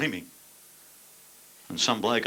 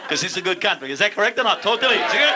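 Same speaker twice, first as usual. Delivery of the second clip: loud crowd call-out; 12 semitones higher, 7 dB louder than usual. Real, not acted. Shouting questions at the crowd.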